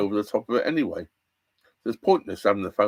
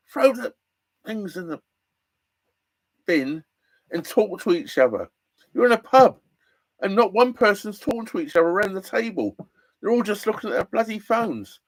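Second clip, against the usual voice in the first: annoyed rant; 10 semitones higher than usual. Real, not acted. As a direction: vent real irritation at phone-staring diners. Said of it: "Oh, it annoys me".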